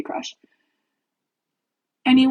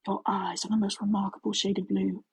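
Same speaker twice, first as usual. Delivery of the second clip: mock sultry voice; 2 semitones lower, 5 dB quieter than usual. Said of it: "Her voice two octaves lower than he's ever heard it".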